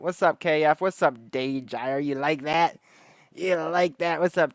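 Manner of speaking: ordinary talk, nothing marked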